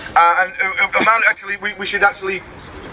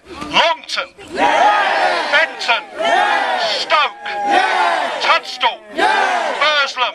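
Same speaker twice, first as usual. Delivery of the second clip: shouting the town names; 7 semitones higher, much slower than usual. Real, not acted. Rally speaker to the march crowd, shouting each town name. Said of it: "I'm gonna say the names".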